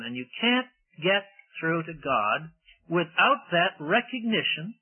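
ordinary talk, nothing marked

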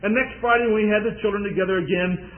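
ordinary talk, nothing marked

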